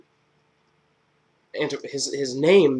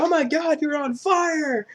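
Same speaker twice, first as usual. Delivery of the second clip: much higher, dragged-out mock alarm, slow; 4 dB louder, slower than usual. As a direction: stretch it out in a high fake panic. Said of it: "he's like".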